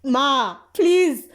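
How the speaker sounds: high, loud, desperate cry of please; sobbing to mom for help; hysterical crying plea. Retold or imitated. Scared kid crying to mom that nobody sees the man, hysterical plea. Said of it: "I'm, like, hysterical ... I'm crying".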